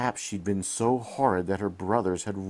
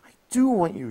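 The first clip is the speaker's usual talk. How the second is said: high, louder protest; 14 semitones higher, 8 dB louder, faster than usual. A narrator's reading. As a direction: insist you want them back. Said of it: "she protested".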